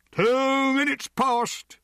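loud, high, drawn-out call; timekeeper's call. A narrator's reading. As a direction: sing out the time over a noisy crowd. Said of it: "called Captain Jimmy".

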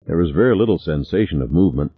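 usual speaking voice

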